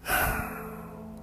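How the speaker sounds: soft, audible exhale; sigh. Real, not acted. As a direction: let out a quiet sigh. Pause after complaining.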